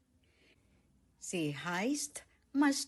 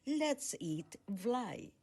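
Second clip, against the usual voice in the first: ordinary delivery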